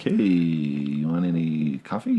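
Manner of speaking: slow, stretched-out "hey"; drawn-out casual offer. Retold or imitated. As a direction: retell it as a lazy, drawn-out offer. Retold movie line, drawn out.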